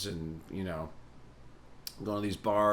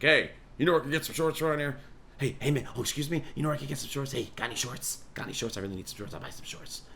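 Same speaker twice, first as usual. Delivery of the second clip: frantic asking; 5 semitones higher, faster than usual. Retold or imitated. Desperately asking strangers for shorts.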